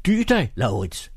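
screamed warning. A narrator's reading. The old angel restrains young Carlsen, high mild scream. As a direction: cry out to stop him, mildly. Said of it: "skreg englen".

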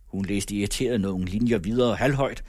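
plain reading voice

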